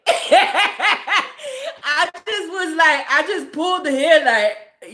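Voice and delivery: high, slow words squeezed through laughter; real laughter carrying into the words; laughing hard. Real, not acted. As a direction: struggle to talk while cracking up. Laughing while retelling the discovery.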